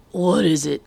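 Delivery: raw, loud rasping question; rasped. A narrator's reading. Old officer with a sore throat demands, rasping loud. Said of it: "he rasped".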